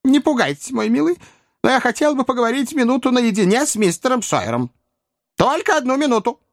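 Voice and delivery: put-on very high old-lady voice; agitated old lady. A narrator's reading. Old lady asking nephew for a private word, high voice. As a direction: plead gently, pitched right up. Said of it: "стараясь отдышаться и дрожа".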